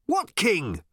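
high, projected query; shouted question. A narrator's reading. Ford questioning Arthur mid-gallop, shouting. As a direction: shout it over the din.

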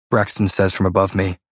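ordinary delivery, nothing marked

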